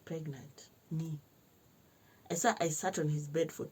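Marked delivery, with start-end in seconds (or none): 0.1-1.1 hushed, stunned echo of the news; quietly taking in the shock, retold; stunned disbelief. Reliving disbelief at the pregnancy news.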